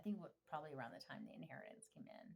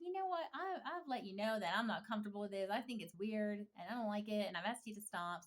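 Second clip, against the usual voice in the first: louder, insistent retelling of standing up; firm retold protest; 3 semitones higher, 6 dB louder than usual. Retelling telling fake parents off, firm and louder. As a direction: lay down the line, raised voice.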